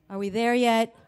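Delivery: imitated caller running out of steam; mock weary, impatient whine. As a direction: ask it like a bored threatener. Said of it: "he gets a little bit less, like, vigorous".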